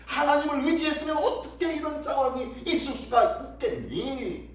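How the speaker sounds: high-pitched, forceful jeering; mocking taunt. Assyrian envoy jeers at trembling Judah, high mocking taunt. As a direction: taunt them as a conquering enemy. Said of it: "라고 하면서 지금 조롱하고 있는 거예요".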